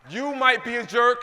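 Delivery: very loud, slow rally shout. Real, not acted. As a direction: shout it even harder to pump up the crowd. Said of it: "come on, louder".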